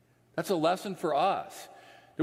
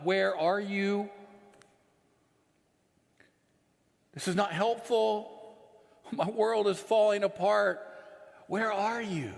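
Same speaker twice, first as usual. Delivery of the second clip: acted-out plea, pitched up; pleading with God; 4 semitones higher than usual. Preacher asking God where he is as his world falls apart, acted-out higher-pitched plea. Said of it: "look up to God and I'm like".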